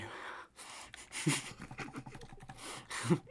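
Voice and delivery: breathy sinister laugh; mock Joker cackle. Streamer follows his villain quote with a breathy cackle. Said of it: "Joker edition".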